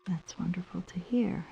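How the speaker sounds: soft sinister whisper. Acted. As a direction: breathe it out quietly. Host whispers creepily.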